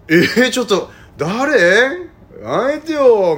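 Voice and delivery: high, loud mock outrage at the culprit; feigned innocence. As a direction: play dumb and accuse loudly, pitch way up. 真っ先にとぼけて他人のせいにする. (しらばっくれて大げさに騒ぐ高い声)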